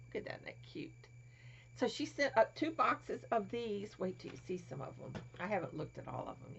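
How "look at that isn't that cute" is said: hushed whisper over a cute toy; soft delighted whisper. Real, not acted. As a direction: breathe it out softly.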